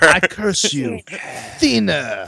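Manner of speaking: mock French curse; French accent. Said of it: "why I went French with that".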